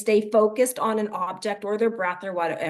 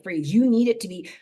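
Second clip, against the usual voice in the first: ordinary talk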